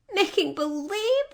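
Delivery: high, shocked disbelief; astonished cry. A narrator's reading. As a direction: repeat it in sheer amazement. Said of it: "cried Dorothy".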